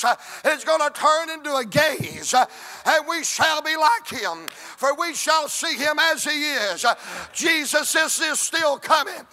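loud, rhythmic revival preaching; preacher rolling into a shout. Acted. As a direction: lift the crowd with every line. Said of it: "I feel like preaching".

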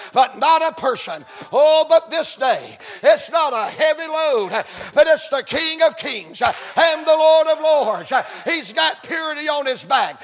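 loud, driving preacher's delivery; fervent revival preaching; full-force preaching. Real, not acted. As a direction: preach it hard in rhythmic phrases, building to a long shout.

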